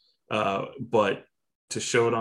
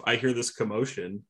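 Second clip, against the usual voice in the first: usual speaking voice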